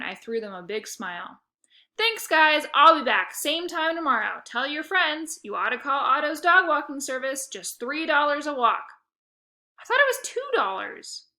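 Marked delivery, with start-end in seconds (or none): 2.0-8.9 loud, high hustler patter; salesman farewell. 9.8-11.3 high, whiny complaint; moaning.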